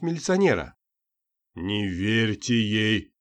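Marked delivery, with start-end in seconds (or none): 1.5-3.1 slow, deep, guttural voice. A narrator's reading. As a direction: drop the voice deep into the belly. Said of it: "утробным голосом произнёс Юдин". (утробным голосом, медленно)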